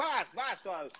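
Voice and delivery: mock sisters teasing. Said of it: "minhas irmãs brigavam comigo, me xingavam, tipo".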